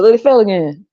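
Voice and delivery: ordinary talk, nothing marked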